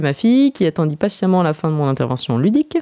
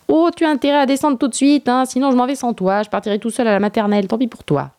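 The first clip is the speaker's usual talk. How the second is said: mock scolding; 7 semitones higher than usual. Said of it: "Je poursuivis".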